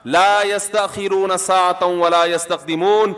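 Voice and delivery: slow chanted Arabic recitation of a verse; Quranic recitation. Acted. Preacher recites a verse to his listeners, slow and chanted. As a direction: intone the verse slowly, like tilawat.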